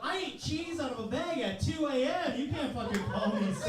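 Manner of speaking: high-pitched, offended protest; mock outrage. Older worker to younger coworkers, mock indignant. Acted outrage at being called sir.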